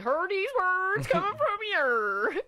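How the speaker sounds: high, slow, drawn-out teasing; mocking sing-song. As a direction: stretch it out in a mocking lilt.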